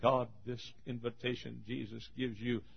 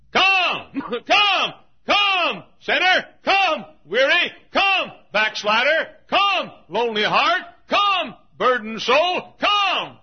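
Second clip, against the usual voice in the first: high, slow repeated calls; shouted altar call; 14 dB louder, slower than usual. Real, not acted. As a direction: summon each sinner at full voice.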